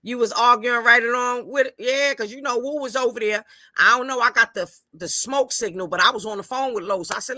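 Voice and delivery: ordinary talk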